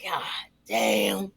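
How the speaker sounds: low, drawn-out frustrated groan of a curse; imitating frustrated David. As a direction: sink the voice low and curse into his hands. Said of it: "he'd grab his face and he'd go".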